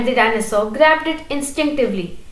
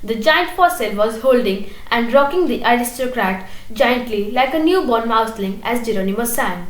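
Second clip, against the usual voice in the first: ordinary delivery, nothing marked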